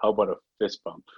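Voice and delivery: a hint of reluctance, dropped low; low, reluctant voice. Actor fending off a fan's second handshake, low and reluctant. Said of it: "in his low voice, uh, with a bit of reluctance".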